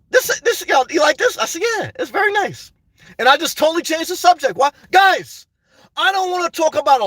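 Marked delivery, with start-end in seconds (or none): 0.1-1.4 imitating the lady. Lady asks about blouse. 1.6-2.7 high polite reply. Retold or imitated. His reply to the lady.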